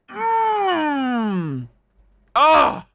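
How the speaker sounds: high savoring mm then a satisfied ah; appreciative hum. Real, not acted. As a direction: relish it like a fine wine. Admiring the kill form.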